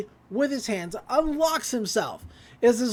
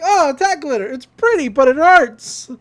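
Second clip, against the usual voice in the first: higher, much louder, slowing at the end; pained cry; 5 semitones higher, 10 dB louder than usual. Retold or imitated. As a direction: wail as the glitter stings. Zeus hit by glitter cloud.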